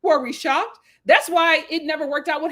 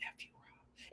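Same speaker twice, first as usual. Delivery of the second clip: whispered curse; 33 dB quieter than usual. Retold or imitated. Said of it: "she was just like".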